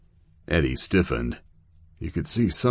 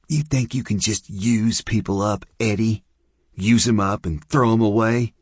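bitter accusation; 6 semitones higher than usual. Acted. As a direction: spit it at him. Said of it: "drunk woman confronts Eddie".

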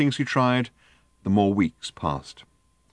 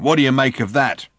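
higher, loud demanding question; triumphant challenge; 5 semitones higher, 6 dB louder than usual. A narrator's reading. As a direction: throw down the proof and demand an answer. Driver triumphantly challenges Arthur.

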